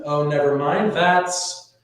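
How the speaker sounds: normal talking voice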